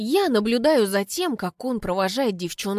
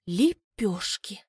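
stunned hush; slow dawning dread; much slower than usual. Acted. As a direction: let the horror sink in word by word. Horrified realization.

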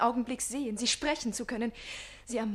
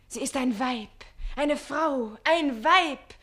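building louder to weib; swelling ardour; 3 semitones higher, 9 dB louder, slower than usual. Acted. Page insisting to Susanne, swelling ardour. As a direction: build it to a cry.

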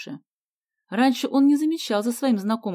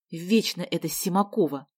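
ordinary delivery, nothing marked

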